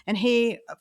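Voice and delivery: normal talking voice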